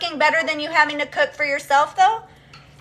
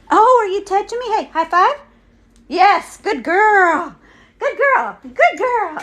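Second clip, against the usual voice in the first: pet praise; 7 semitones higher, 3 dB louder than usual. Real, not acted. Praising dog for a high five.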